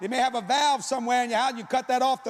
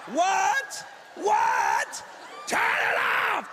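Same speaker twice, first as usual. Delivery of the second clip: high panicked yell; scalded shriek; 8 semitones higher, much slower than usual. Retold or imitated. Scalded husband yelling at his wife to turn the water off. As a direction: scream it like you're being scalded.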